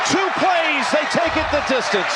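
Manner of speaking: screaming high, thrilled play-by-play; excited touchdown call. Real, not acted. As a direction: call the score at the top of your voice.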